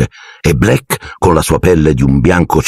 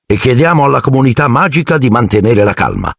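higher-pitched official statement; lightly voiced minister; 8 semitones higher than usual. A narrator's reading. Minister reassuring the public through the newspaper, lightly voiced.

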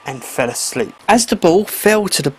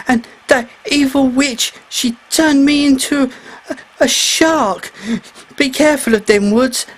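lightly higher, earnest voice; grateful mer boy; 6 semitones higher than usual. A narrator's reading. Mer boy warns the mermaid, lightly voiced. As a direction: warn her kindly about the witch.

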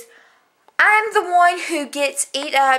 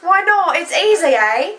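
mock Canadian, louder; 3 semitones higher, 4 dB louder than usual. Teasing in accent game.

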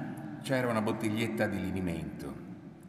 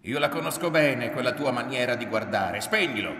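loud, high accusation ending in a command; heated, full-voiced shouting; angry yell; 4 semitones higher, 10 dB louder than usual. A narrator's reading. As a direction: yell it as a furious order. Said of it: "urlò".

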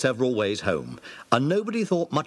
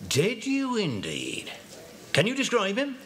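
put-on higher, friendly police inspector greeting the children; 10 semitones higher, 3 dB quieter than usual. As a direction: play the amused local inspector. Narrator voices the police inspector.

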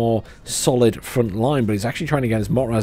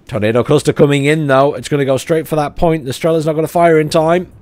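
rising excitement, higher and louder; excited; 5 semitones higher, 6 dB louder than usual. Real, not acted. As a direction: call the incoming strike urgently. Air strike incoming, live call.

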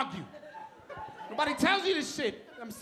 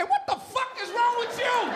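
annoyed wife; 8 semitones higher than usual. Acted. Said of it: "She will look at me and say".

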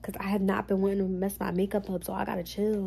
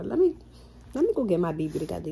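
ordinary delivery

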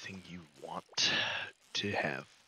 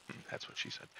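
muttered joke; 8 dB quieter than usual. Real, not acted.

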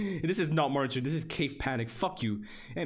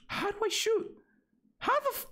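squeaky voice. Real, not acted. Squeaky frustrated voice.

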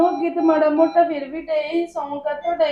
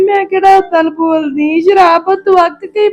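crying it out, much louder; loud sobbing plea; 11 dB louder than usual. Acted. Wife pleading with her mother over her husband's fights, loud sobbing. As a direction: sob out the excuse.

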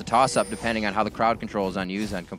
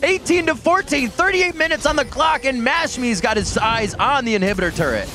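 high, loud esports shoutcast; excited play-by-play; 13 semitones higher, 6 dB louder than usual. Real, not acted. Caster calling a team fight as it breaks out, excited play-by-play. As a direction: call the fight at a fever pitch.

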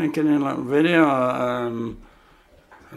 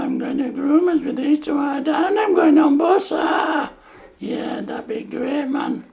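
higher, louder put-on dog voice, shouting with excitement; a dog telling dad about groomers, higher, loud and eager; excited dog voice; 11 semitones higher, 6 dB louder than usual. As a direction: voice the dog thrilled about the bus.

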